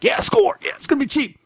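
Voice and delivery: high, gleeful cheer; excited. Retold or imitated. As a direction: squeal over the bargain. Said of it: "It's like".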